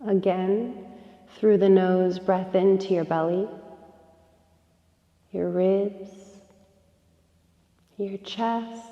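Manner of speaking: soft, unhurried yoga nidra guidance with long pauses; guided meditation. Real, not acted. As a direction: gently guide them into rest, breath by breath. Leads a yoga nidra relaxation.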